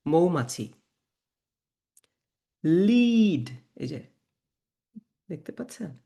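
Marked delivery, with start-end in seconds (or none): 2.6-3.5 লিড with long "ee", higher and louder; German pronunciation demo. Shown as a demonstration. Teacher models word for song, লিড held long and loud. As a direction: hold the vowel of লিড firmly. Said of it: "সেটার মতো উচ্চারণ করতে হবে".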